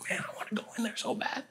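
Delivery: high, squeaky half-whispered whine; whispered longing. Retold or imitated. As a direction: whine it under your breath, acting desperate to get in. Retelling his longing outside the show.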